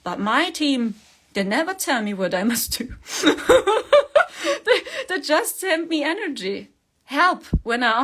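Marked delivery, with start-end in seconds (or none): 2.9-4.6 a loud, high burst of laughter; real amused laugh. Real, not acted. Laughs at team never advising.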